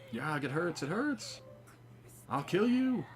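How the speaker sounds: high strained voice, rising to a threat; hammed-up dying villain. Player voicing Olivia wounded, threatening revenge.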